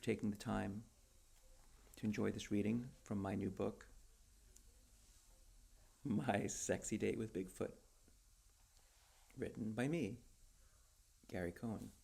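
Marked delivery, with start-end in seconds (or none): none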